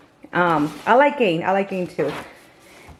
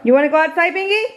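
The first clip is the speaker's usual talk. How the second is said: high, bright sing-song asking the dog out; excited pet talk; 9 semitones higher, faster than usual. Real, not acted.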